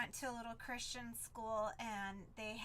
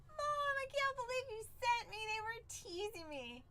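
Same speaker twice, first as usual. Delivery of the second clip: high-pitched imitation of a tearful kid; crying child; 9 semitones higher than usual. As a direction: whine to mom as an upset little girl, retold. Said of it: "and she started crying".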